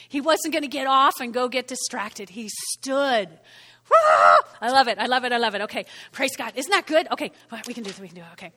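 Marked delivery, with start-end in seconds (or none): 3.9-4.5 high, loud whoop; excited whoop. Real, not acted. Preacher delighted that Nehemiah stood his ground, whooping. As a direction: let out a real cheer.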